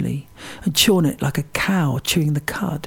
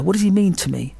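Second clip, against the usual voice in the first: ordinary talk, nothing marked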